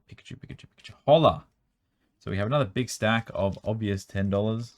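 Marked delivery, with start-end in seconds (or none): 0.1-0.9 breathy hush of delight at the cards; excited whispering; whispered. Real, not acted. Excited over a Pikachu stack. 1.1-1.5 excited shout. Real, not acted. Excited over the cards.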